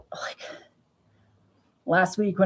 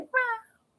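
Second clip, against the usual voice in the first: cat waking meow; 6 dB quieter than usual. Retold or imitated. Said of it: "She's like".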